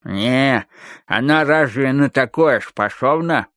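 slow, slurred doubting footballer; mumbling through mouthguard. A narrator's reading. Footballer doubts what his skinny mate claims, mumbling slowly. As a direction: chew the words round a mouthguard. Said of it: "слова выходили невнятными и скомканными".

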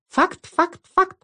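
tiny high squeaking voices; squeaky voices. A narrator's reading. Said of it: "запищали голоса".

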